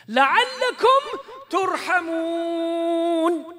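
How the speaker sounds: loud, high ringing proclamation; shouting. Acted. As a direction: cry out the verse with fervour. Shouted verse climax.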